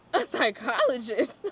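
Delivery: can't believe the offer is real; stunned disbelief. Retold or imitated. Hearing she'll interview a psychologist, in loud disbelief. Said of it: "And I'm like".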